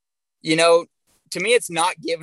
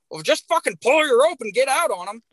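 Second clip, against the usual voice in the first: higher mock retort from an annoyed stock contractor; a light imitation of a curt comeback; snapping back; 8 semitones higher than usual. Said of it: "he kinda snaps back at me".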